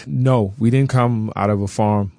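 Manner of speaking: ordinary talk, nothing marked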